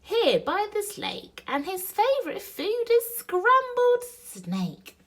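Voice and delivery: high, loud little mouse voice; cheeky mouse. Acted. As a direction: pipe it up high to scare off a snake. Mouse scares off the snake.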